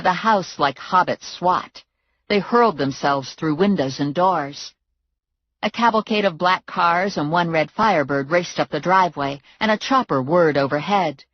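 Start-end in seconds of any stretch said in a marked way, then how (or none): none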